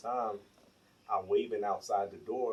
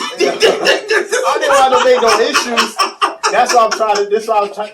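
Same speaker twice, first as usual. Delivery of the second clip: very high, loud, laughing self-defence; real cracking-up, voice way up; laughing protest; 12 semitones higher, 20 dB louder, slower than usual. Real, not acted. Laughing protest amid friends' teasing.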